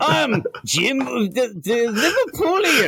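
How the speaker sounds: very high, hammed-up Liverpool accent; bad Liverpool accent. Jim introducing himself as the Liverpoolian, bad Liverpool accent. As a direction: announce yourself in a squawky fake Liverpool accent. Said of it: "That is not a Liverpool accent".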